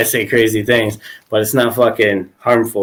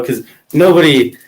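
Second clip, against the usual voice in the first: ordinary delivery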